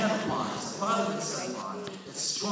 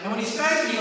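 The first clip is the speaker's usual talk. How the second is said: ordinary talk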